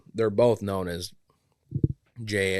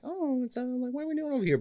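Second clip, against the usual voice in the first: much higher, fast put-on voice; imitating a character voice; faster than usual. Friend mimicking the audiobook reader's higher character voice. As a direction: chirp it like a cartoon kid. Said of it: "he'll act like".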